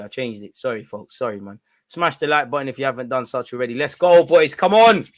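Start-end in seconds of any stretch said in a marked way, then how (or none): 3.7-5.0 loud, excited cheer to the team; rallying shout. Real, not acted. Streamer urging the boys on before kickoff, rallying shout.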